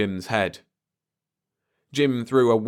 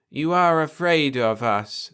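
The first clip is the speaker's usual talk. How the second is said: soft alien voice; 6 semitones higher than usual. A narrator's reading. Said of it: "said the soft voice".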